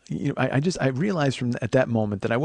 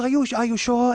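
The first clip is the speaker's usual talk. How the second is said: high, timid woman's question; lightly voiced concerned masseuse; high falsetto worry; mimicking the masseuse; 5 dB louder than usual.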